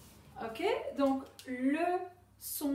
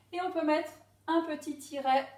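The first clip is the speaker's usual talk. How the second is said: normal talking voice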